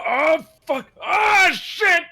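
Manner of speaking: high-pitched pained shrieks; acted screaming. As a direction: scream for help as a character being mauled. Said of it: "as I'm screaming it out".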